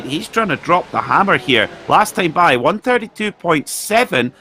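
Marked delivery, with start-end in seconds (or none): 1.9-4.3 raised, driving play-by-play call as the cars battle; live race commentary. Acted. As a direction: call the overtake live, building urgency.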